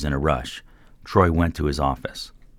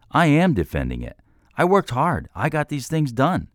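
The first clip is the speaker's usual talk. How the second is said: much higher, louder insistence; heated protest; 12 semitones higher, 4 dB louder than usual. A narrator's reading. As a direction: insist on the hard work, voice climbing. Troy argues back heatedly.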